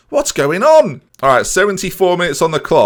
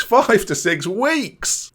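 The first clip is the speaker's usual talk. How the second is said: higher, very slow incredulous repeat; shocked disbelief; 8 semitones higher, much slower than usual. Real, not acted. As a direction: stretch each word in disbelief.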